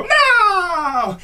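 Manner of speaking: high, loud mock shout; kids yelling. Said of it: "they go".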